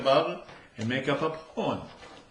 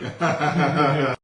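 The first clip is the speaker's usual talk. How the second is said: loud laugh; 3 semitones higher, 7 dB louder than usual. Real, not acted. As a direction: burst out laughing. Laugh after punchline.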